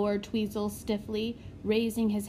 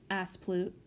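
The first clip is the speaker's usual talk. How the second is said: ordinary delivery, nothing marked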